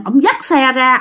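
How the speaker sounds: ordinary delivery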